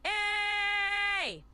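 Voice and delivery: loud, high, held A; drawn-out A with extra force; emphasized long A. Shown as a demonstration. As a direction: push a sustained A up high and loud. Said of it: "but I'll emphasize it more".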